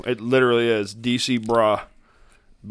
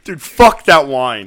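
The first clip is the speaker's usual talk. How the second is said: loud slow rant; 8 dB louder, much slower than usual. Acted. Rejecting wine loudly.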